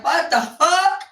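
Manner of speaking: loud, high, drawn-out disbelief; indignant outburst. Acted. Reacting to someone refusing the laundry, indignant.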